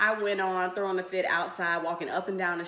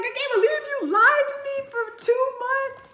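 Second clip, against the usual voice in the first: much higher, upset outburst; hurt accusation; 5 dB louder than usual. Retold or imitated. Girlfriend upset at boyfriend, high hurt outburst. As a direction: cry out the betrayal. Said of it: "I'm like".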